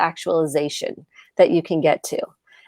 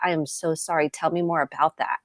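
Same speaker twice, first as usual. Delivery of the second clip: much softer, gentle and sincere; tender apology; 2 semitones lower, 7 dB quieter than usual. Retold or imitated. Mother apologizing to her daughter.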